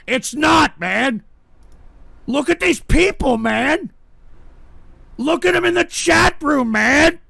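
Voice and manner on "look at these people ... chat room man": high, pleading indignation; aggrieved outcry. Real, not acted. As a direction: cry out for someone to witness the mockery. Host aghast at chat.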